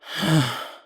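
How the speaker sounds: heavy, exasperated sigh; weary sigh. Acted. Weary confession, sighs before continuing.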